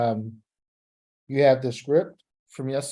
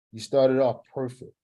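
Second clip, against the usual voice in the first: usual speaking voice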